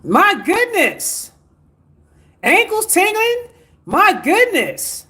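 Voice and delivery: high, slow, drawn-out amazement; amazed. Real, not acted. Healer reacting in awe to viewers' reports, high and slow. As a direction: stretch out each exclamation in wonder.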